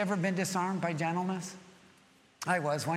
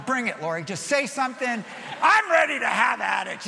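louder and higher, itching for a fight; gunslinger bravado; 4 semitones higher, 6 dB louder than usual. Retold or imitated. Husband walks into kitchen ready to fight, loud challenge. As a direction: dare her to start something. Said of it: "I'm like a gunslinger".

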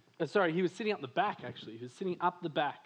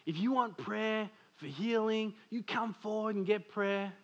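quoting the preacher; 4 semitones higher than usual. Retold or imitated. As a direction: lightly voice the preacher calling people forward. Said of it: "And the preacher says".

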